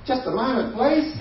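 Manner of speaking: put-on high-pitched voice of a young officer; young customs officer. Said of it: "pimples, young".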